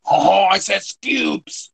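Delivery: put-on slow high French-accented scoops; mock French. Imitating the GM's French voice, slow and high. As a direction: ham up the French GM. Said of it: "imitating the French GM".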